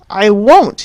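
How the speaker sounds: loud defiant shout back; shouted refusal. A narrator's reading. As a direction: hurl the refusal back at mother. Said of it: "he shouted back".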